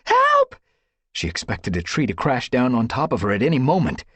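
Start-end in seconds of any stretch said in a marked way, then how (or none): 0.0-0.5 piercing cry for help; shriek. A narrator's reading. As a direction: scream for help, terrified. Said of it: "she shrieked".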